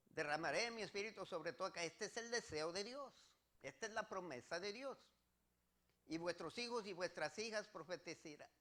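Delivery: ordinary delivery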